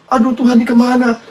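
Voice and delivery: high, lamenting cry; acted plaintive wail; tearful distress. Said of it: "Dia nangis sedih banget".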